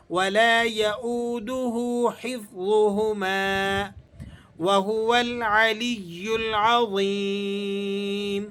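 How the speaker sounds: slow, drawn-out chanted recitation; measured melodic Quran reading. Shown as a demonstration. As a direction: recite with tajweed, stretching the vowels.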